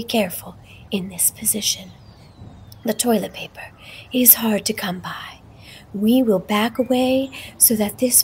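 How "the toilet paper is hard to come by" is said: ordinary delivery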